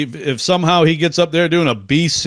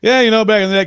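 high, louder mock Klatt; imitating Joel Klatt; 9 semitones higher, 4 dB louder, much faster than usual. Host mocking Klatt's analyst voice, high and loud. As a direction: lightly ham up a TV analyst. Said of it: "he says it like".

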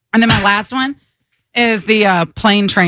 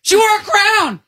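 indignant meme rant; 10 semitones higher than usual. Acted. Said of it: "Grow up".

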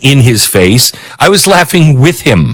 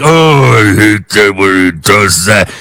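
mock whiny critic; 6 semitones lower than usual. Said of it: "you're like".

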